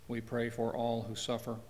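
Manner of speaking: normal talking voice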